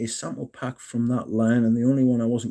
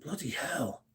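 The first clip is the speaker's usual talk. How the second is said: hushed bloody hell; 3 semitones higher, 14 dB quieter than usual. Retold or imitated. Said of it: "I was like".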